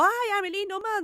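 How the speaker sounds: put-on a firm everyday yell at the child; normal scolding yell. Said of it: "pero normal".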